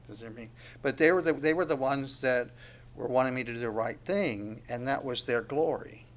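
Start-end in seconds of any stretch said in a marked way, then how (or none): 0.1-0.5 much softer, half to himself; quiet amused repeat. Shown as a demonstration. Preacher repeating the line to the congregation, softly amused. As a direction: echo it under your breath, amused.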